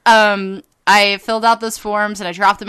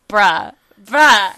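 excited bruh; 7 dB louder, much slower than usual. Real, not acted. Raving about the sim update.

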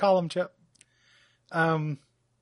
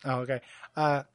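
normal talking voice

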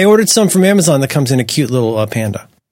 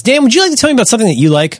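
ad transition; 10 semitones higher, faster than usual. Acted. Ad read transition.

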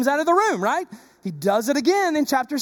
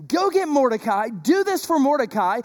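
normal talking voice

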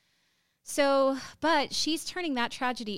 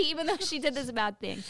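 normal talking voice

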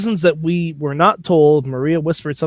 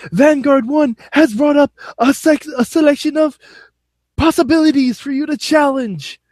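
high, put-on wrestler voice; Matt Hardy voice; 10 semitones higher than usual. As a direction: ham up the wrestler's grand announcement.

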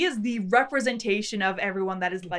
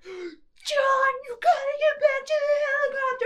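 much higher, crying-out panic; hammed-up frantic helicopter crew calling John; mock panicked cry. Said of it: "people in the helicopter are like".

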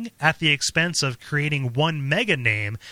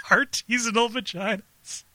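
higher-pitched, laughing echo; laughing disbelief; 8 semitones higher than usual. Real, not acted. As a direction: repeat it through a laugh, incredulous. Echoes the news in laughing disbelief.

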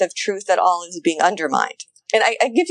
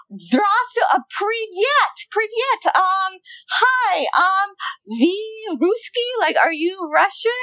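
high, slow, halting attempt at Russian greetings; a bumbling host trying out his three words of Russian; clueless American host; 12 semitones higher, much slower than usual. Mocking the Russia Today host as he greets soldiers in broken Russian. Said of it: "And he's like".

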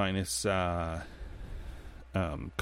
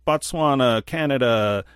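mock yelling; 4 semitones higher, 4 dB louder, slower than usual. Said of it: "They're yelling".

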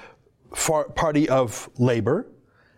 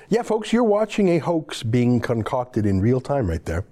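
ordinary delivery

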